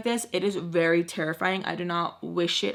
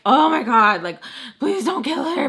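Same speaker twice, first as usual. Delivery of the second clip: higher, louder frightened plea; retold panicked begging, louder and higher; scared plea; 6 semitones higher, 6 dB louder than usual. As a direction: beg them not to hurt her. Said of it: "because I'm so scared".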